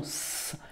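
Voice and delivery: S said as a long hiss, sss; letter sound. Shown as a demonstration. Teacher shows pupil the end of the name, sounding S as a hiss. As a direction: hold S as a pure consonant, no vowel. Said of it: "tu entends le son S".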